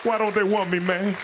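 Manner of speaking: high, loud tearful wail; mock crying. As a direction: wail it on her shoulder, hammed-up. Mock breakdown during the hug.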